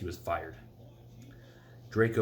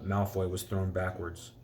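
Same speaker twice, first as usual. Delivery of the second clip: ordinary delivery, nothing marked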